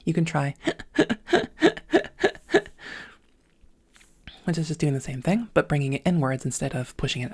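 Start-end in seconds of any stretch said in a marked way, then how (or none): 0.6-3.0 laughing while breathing in, very high and airy; gasping in-breath laugh; inward laugh. Shown as a demonstration. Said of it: "try the inward laugh instead".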